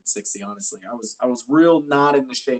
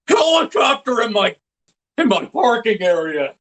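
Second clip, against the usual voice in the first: lightly voiced Joe, jumping high on helicopter; a hint of Joe's gruff complaint, shouting one word; Joe Swanson impression; 5 semitones higher than usual. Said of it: "like, Joe".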